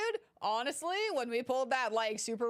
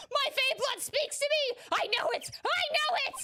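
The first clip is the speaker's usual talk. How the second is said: high, loud declaration; very high, loud and sure; triumphant cry; 6 dB louder than usual. Acted. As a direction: shout it like a psychic vision. Maya's triumphant character line.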